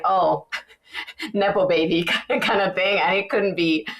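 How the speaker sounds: normal talking voice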